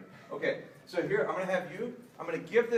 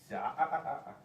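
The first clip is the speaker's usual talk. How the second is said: correcting ah ah. Real, not acted. Correcting the puppy.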